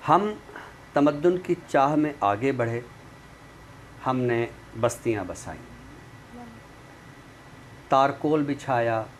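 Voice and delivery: measured, paced reading of a prose poem; poem recital. Acted. Poet reads his prose poem to the viewers, measured recital. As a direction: recite each line with weight and long pauses.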